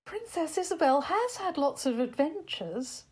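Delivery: ordinary delivery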